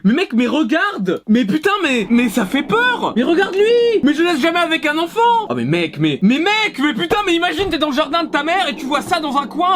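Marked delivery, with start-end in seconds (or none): 0.0-5.5 panique surjouée. Acted. Panics at a creepy garden gnome. 6.2-9.8 high, hammed-up fright; panique surjouée. Imagines meeting the gnome at night.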